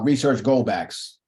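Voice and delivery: ordinary talk, nothing marked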